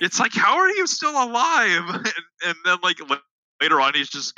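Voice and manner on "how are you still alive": high, slow mock disbelief; hammed-up astonished squeal, slow. Quoting a shocked King Arthur.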